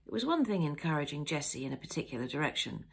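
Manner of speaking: ordinary delivery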